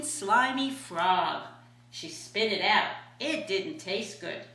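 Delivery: ordinary delivery, nothing marked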